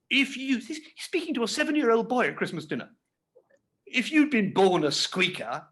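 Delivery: high, self-important uncle, slowing on squeaker; a pompous uncle lecturing the boy; pompous Pumblechook. A narrator's reading. Reader voicing Pumblechook.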